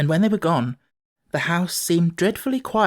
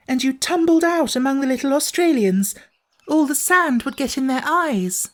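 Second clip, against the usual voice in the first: put-on much higher, worried little girl; a small girl fretting about falling through; high anxious child voice; little girl's voice; 10 semitones higher than usual. A narrator's reading. Jane continues.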